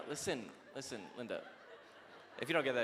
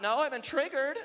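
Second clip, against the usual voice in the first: put-on whiny young recruit; 11 semitones higher, 6 dB louder than usual. Mocking feeble young recruits.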